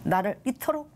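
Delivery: ordinary talk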